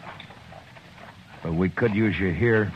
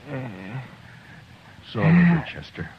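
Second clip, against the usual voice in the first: slow, drawn-out comfort to a wounded friend; 6 semitones higher, 4 dB louder, much slower than usual. Acted. Marshal comforting his wounded deputy, slow and tender. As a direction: soothe him, stretch the words.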